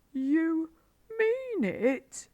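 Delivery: very high, rising disbelief; amazed Badger. A narrator's reading. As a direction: Badger can't believe his ears. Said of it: "cried Badger".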